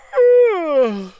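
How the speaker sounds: bored yawning sigh. Acted. Narrator too bored, yawning.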